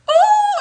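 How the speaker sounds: high, loud laughing "ooh"; excited laughing whoop. Real, not acted. Telling viewers about the shaking cathedral, a loud laughing whoop.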